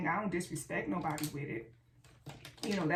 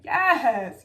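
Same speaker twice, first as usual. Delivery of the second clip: loud, delighted Yes; a big thrilled yes at a fresh car wash; excited yes; 3 semitones higher, 13 dB louder than usual. Retold or imitated. Vlogger imitates loving her car wash, excited. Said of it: "you be like".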